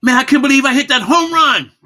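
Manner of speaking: high, loud burst of excitement; excited exclamation. Shown as a demonstration. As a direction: shout it like you just hit a home run. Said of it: "deliver a heartbeat of a message".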